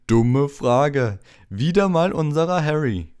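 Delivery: booming, loud, high flourish; big self-important jovial voice; patronising Lockhart. A narrator's reading. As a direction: boom it out condescendingly. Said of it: "dröhnte gönnerhaft".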